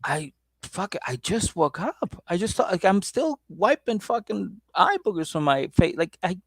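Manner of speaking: high, whiny groggy voice; groggy boyfriend. Retold or imitated. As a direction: whine that you just woke up. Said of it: "he's like".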